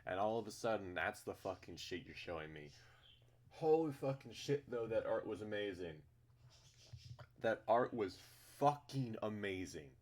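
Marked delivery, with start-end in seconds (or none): 3.5-9.9 amazed. Real, not acted. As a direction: gush about it excitedly. Praising the art.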